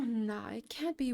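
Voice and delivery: ordinary delivery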